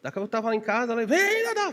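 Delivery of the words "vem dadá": very high squawked imitation of the call; parrot squawk. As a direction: screech it like the parrot. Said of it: "O papagaio começou a gritar".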